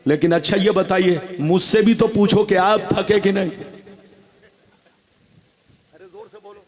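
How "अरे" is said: ordinary delivery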